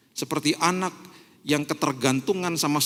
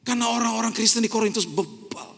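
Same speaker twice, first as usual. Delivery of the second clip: ordinary talk, nothing marked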